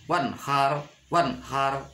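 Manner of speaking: "wanhar" with a short crisp n; "wanhar" without any hum; clear idhar reading. Shown as a demonstration. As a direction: repeat "wanhar", n cut before the h. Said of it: "harus jelas nun mati".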